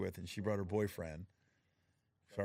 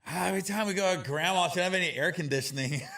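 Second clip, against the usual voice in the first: put-on high, louder kid voice complaining; whiny kids; 8 semitones higher, 7 dB louder than usual. Kids griping to their dad about grandma's car, whiny and high. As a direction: whine like his kids.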